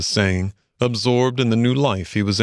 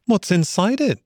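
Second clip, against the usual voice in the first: high-pitched, eager question; lightly excited, inquisitive Mole; curious; 11 semitones higher than usual. A narrator's reading. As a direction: ask as if wriggling with curiosity. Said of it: "wriggling with curiosity".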